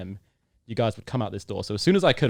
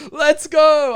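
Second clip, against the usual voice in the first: loud, high celebratory shout; going crazy; 11 dB louder than usual. Acted. Player celebrating his meeting with the coach, ecstatic yell. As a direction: yell it out, overjoyed. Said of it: "going crazy".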